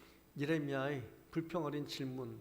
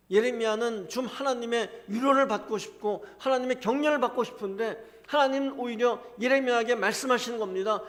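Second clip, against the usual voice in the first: fervent preaching; 8 semitones higher, 12 dB louder than usual. Acted. Preaching at full force.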